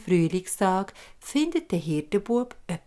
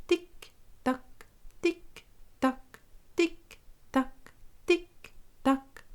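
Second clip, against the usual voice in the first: high, soft, slow tick-tack; ticking clock; 7 semitones higher, 5 dB quieter, much slower than usual. Acted.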